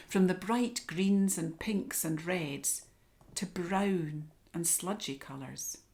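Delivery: plain reading voice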